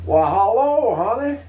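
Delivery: exaggerated Texas accent, rising high; Lyndon Johnson. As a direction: lay on a big flirty Texas accent. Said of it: "pronounced Texas accent like that".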